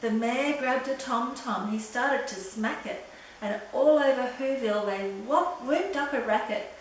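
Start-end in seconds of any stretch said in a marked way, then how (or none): none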